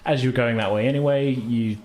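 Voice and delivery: ordinary talk, nothing marked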